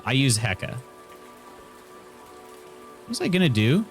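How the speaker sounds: ordinary talk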